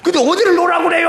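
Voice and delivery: fast, high, loud protest; frantic protest. Retold or imitated. Deacon shouting back at God about letting go.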